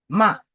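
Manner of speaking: "ma" falling sharply; short, emphatic drop on "ma"; fourth tone. Shown as a demonstration. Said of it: "le quatrième ton".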